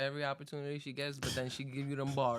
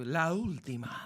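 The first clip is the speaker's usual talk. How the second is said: booming fight announcer call; Spanish announcer voice; 3 semitones higher, slower than usual. Pretend. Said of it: "gotta use the Spanish announcer voice".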